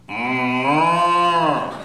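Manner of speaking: loud hum imitating a cow; ordering milk with a sound effect; mimed moo. Shown as a demonstration. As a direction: make the animal noise to order. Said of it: "It's all sound effects".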